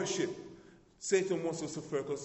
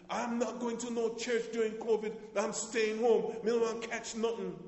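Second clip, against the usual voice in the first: high, loud Jamaican patois outburst; Jamaican accent; 9 semitones higher, 4 dB louder than usual. Retold or imitated. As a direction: mimic a Jamaican churchgoer's loud refusal. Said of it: "my Jamaican accent and whatever else".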